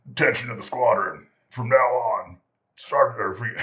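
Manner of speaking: loud, mock-official squadron announcement; intercom announcement. Boss addresses the squadron on the pager, loud announcement. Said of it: "puts the pager in, and he goes".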